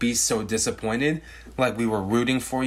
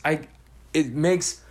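ordinary talk

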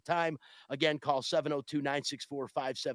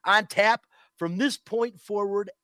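louder, higher title punch; emphatic; 5 semitones higher, 8 dB louder, slower than usual. Acted. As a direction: hit the headline hard. Host punches segment title.

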